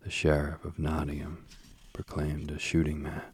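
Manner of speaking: ordinary delivery, nothing marked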